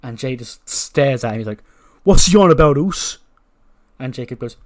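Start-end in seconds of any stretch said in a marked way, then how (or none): 2.0-3.2 loud, high, irate outburst; angry confrontation. Acted. Jay challenges Seth to his face, angry and loud. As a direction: demand an answer, loud and high.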